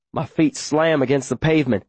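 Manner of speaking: plain reading voice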